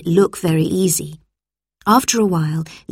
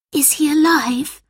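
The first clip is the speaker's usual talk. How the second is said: ordinary delivery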